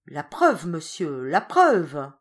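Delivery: loud, shaken demand for proof; hoarse trembling outcry. A narrator's reading. Old father demanding proof from the notary, loud hoarse trembling cry. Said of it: "d'une voix rauque et tremblante".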